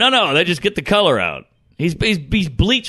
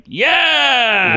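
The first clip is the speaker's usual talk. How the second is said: high strained yeah; a wailing mock yell; mock cry out; 8 semitones higher than usual. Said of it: "I was like".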